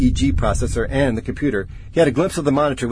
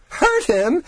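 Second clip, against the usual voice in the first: high, rising incredulous echo; hysterical disbelief. A narrator's reading. Researcher retorting to his colleague, hysterical disbelief. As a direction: throw the words back as a wild question.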